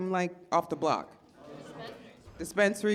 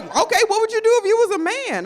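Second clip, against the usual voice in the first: mock boyfriend retort; 11 semitones higher, 6 dB louder, much faster than usual.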